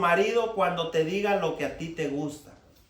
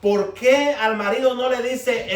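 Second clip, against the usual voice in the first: preaching challenge; 5 semitones higher, 6 dB louder, slower than usual. Real, not acted.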